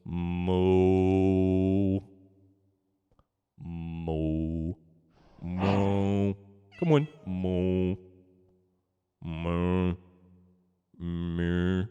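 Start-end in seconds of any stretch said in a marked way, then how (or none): 0.0-6.3 low, drawn-out moo; long low imitation cow. Shown as a demonstration. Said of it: "It goes". 7.2-11.9 low, drawn-out moo; long low imitation cow. Shown as a demonstration. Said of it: "It goes".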